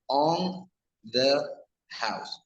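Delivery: "on", "the", "house" each said apart with a pause between; words said separately. Shown as a demonstration. Teacher showing learners "on the house" word by word. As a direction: space out every word of "on the house" distinctly. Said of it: "When we separate these three words".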